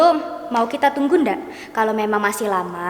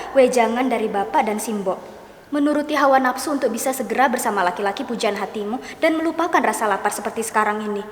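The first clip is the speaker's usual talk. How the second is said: ordinary talk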